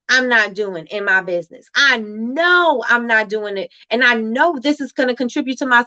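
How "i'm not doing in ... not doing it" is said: ordinary delivery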